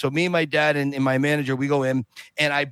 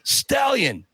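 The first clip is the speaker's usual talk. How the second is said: Apollo Creed; 2 semitones higher, slower than usual. Retold or imitated. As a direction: imitate a showboating boxer baiting his rival. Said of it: "he turns into Apollo Creed".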